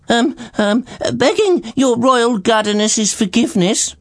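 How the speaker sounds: high, flustered servant voice blurting it out; nervous old servant, high and rushed; servant's blurted apology. Acted. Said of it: "blurted Groggins".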